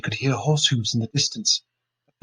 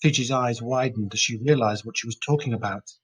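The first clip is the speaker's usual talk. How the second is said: usual speaking voice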